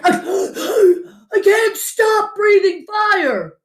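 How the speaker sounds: high, slow, strained cry; panicked. A narrator's reading. Father can't stop his flames, panicked cry. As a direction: cry out helplessly in alarm. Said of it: "Crispin's father panicked".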